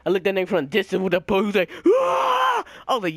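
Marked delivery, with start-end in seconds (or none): 1.9-2.6 very high drawn-out scream; long high-pitched mock war cry; imitated zombie scream.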